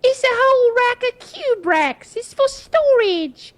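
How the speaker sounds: high, slow, sing-song imitation of his wife; husband mimicking his wife's high, chirpy explanation. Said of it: "And she's like".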